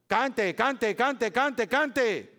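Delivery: emphatic repetition. Real, not acted. Pastor urging the congregation to sing, emphatic. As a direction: pound the word cante like a drumbeat. Pastor hammers home the command.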